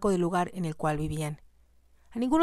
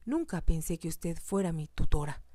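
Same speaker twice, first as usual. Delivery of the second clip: plain reading voice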